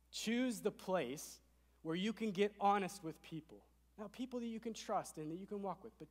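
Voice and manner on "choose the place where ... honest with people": ordinary talk, nothing marked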